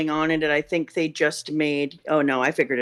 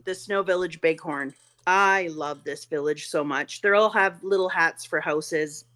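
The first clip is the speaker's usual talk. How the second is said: ordinary delivery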